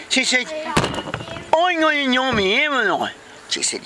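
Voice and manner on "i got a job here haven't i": high, slow, garbled character voice; upset Vicky. Acted. Vicky confronts the boss, high garbled indignation. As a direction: confront in a mangled put-on voice.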